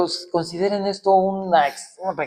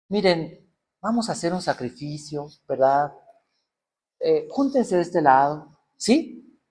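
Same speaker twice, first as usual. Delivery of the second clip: timid mock Elijah; 4 semitones lower, 8 dB quieter than usual.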